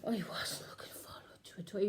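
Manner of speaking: hushed, unvoiced whisper; nervous whisper to the viewer; whispered dread. Nervous confession aside.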